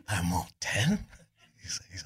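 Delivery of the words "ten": incredulous guess. Retold or imitated. Guessing ever lower sales, disbelieving.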